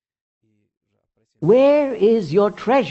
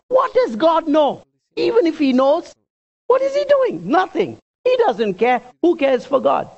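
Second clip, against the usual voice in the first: high, loud mock defiance of God; scoffing; 10 semitones higher, 4 dB louder than usual. Preacher voices the arrogant wicked scoffing at God, high and loud. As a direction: scoff it out, pitched well up.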